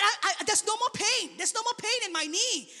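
high-pitched retold disbelief; imitated tearful disbelief. Imitating woman crying over her healed knee, tearful disbelief. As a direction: imitate her overwhelmed cry, pitched well up. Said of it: "she's crying and she's saying".

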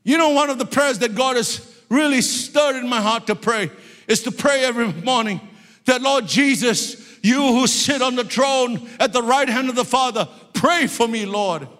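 high, earnest pulpit prayer; fervent preaching. Real, not acted. Preacher asking Jesus to pray for him, fervent.

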